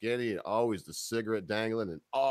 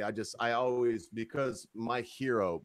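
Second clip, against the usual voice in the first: normal talking voice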